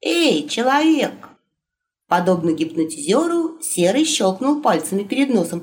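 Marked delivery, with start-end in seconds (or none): 0.0-1.3 calling out. Acted. Calling a servant.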